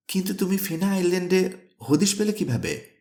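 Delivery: high-pitched, bewildered question; astonished. A narrator's reading. Nephew asks uncle how he found the island, astonished. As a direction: ask it high, in amazement.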